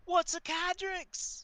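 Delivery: high, slow squeak of a question; baffled kid. Acted. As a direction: ask it high and drawn out.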